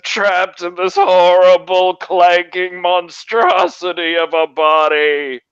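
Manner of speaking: high, slow, loud lament; anguished trapped creature. Acted. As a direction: wail about being trapped. Player voices trapped creature.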